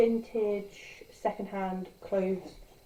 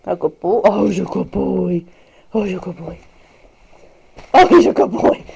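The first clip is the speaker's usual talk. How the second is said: praising the dog; 16 dB louder than usual. Real, not acted. Praising her dog Riley in doting baby talk. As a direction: gush over the puppy.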